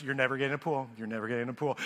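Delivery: low, sinking mock drone; gloomy imagined verdict. Homeowner hearing only bad news from pool man, low drone. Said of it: "all I hear is".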